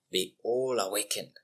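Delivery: usual speaking voice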